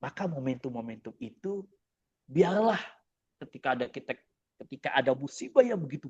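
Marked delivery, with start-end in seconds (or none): none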